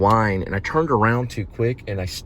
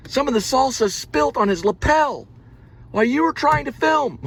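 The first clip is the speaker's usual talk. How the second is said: outraged complainer. Acted. Accusing someone of filming a man eating salsa, mock outrage. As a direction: build to a high, scandalised accusation. Same acted complaint, resumed.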